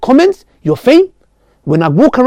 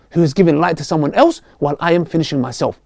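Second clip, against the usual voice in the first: ordinary talk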